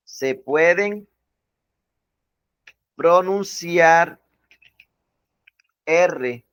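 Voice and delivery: each word drawn out slowly for note-taking; slow dictation. Shown as a demonstration. Teacher dictating to students, slow and deliberate. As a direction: spell it out slowly so they can write.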